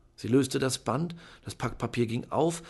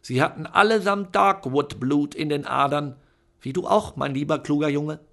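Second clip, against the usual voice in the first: loud, higher, proud proclamation; grand aunt; 4 semitones higher, 8 dB louder than usual. A narrator's reading. Aunt proudly telling Charlie about his relatives. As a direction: announce it with pride. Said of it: "sagte Tante Lucretia".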